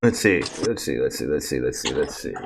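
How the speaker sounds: low, soft repeated muttering; quiet, low filler while thinking; muttering to self. Real, not acted. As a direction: mumble it under your breath while searching. Host searches for next topic.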